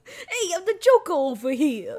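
lightly hammed wise-guy villain; brash mock villain, announcing himself; Joker. Friend repeats the running impression to co-hosts, silly wise-guy villain voice. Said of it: "my Joker impression".

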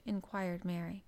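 ordinary delivery, nothing marked

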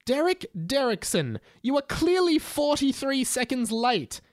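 high, strained scolding yell; a hammed-up teacher screaming at a late pupil. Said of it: "Mister Charger screamed".

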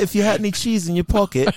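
playful put-on British-style accent; British or Australian accent. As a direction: show off your best British or Aussie accent. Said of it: "that's not bad".

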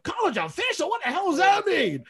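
high-pitched incredulous outburst; losing it. Retold or imitated. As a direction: imitate a coach erupting in disbelief. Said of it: "he lost his shit on that".

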